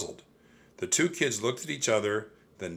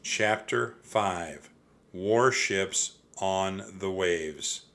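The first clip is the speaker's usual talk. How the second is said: ordinary delivery, nothing marked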